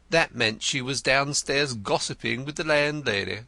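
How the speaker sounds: Denzil the poet. A narrator's reading. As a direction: play him florid and eager. Denzil answers Wimp.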